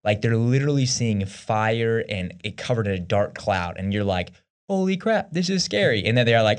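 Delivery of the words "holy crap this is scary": high-pitched imitation of scared onlookers; hammed-up fright; mock awe. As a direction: play being spooked, pitched well up. Voicing people awed at the mountain.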